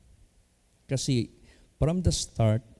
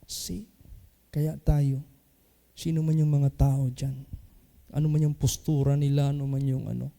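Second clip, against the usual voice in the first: ordinary talk, nothing marked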